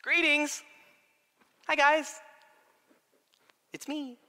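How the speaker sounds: high-pitched, playful little hellos; comic casual greeting. Acted. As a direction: greet startled friends as if nothing happened. Comic Jesus greeting startled disciples.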